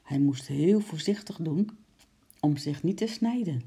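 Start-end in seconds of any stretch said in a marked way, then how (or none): none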